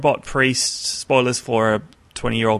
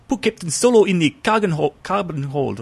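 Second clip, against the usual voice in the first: high, badly botched New Zealand accent; a clumsy try at a New Zealand accent, pitched well up; Boba Fett New Zealand accent; 7 semitones higher than usual. Co-host mimicking the redubbed Boba Fett in a bad New Zealand accent. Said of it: "I can't do a New Zealand accent".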